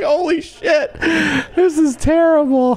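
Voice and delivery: squealing mock despair; mock horrified wail. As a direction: wail it like it's a disaster. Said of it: "You're like".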